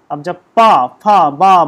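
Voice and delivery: पा, फा, बा said loud, one at a time; teacher modelling पा फा बा; sounding out lip consonants. Shown as a demonstration. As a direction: pop each syllable for the class. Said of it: "भा बोलेंगे तो".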